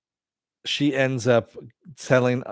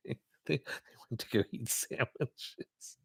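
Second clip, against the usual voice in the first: squeezed out softly through real laughter; losing it mid-sentence, breathless with giggles; helpless laughter; 6 semitones higher, 4 dB quieter than usual. Real, not acted. Host cracking up at the sandwiches joke, laughing. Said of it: "that's actually pretty fucking funny".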